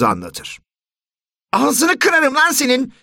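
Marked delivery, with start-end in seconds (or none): 1.5-2.9 high, loud, slow furious threat; older brother threatening the younger one, loud and furious; angry threat. Acted. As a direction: snarl it at your little brother. Older son threatens his brother.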